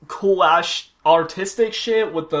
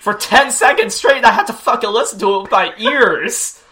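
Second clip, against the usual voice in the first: sarcastic; 2 semitones higher, 7 dB louder than usual. Retold or imitated. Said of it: "be all like".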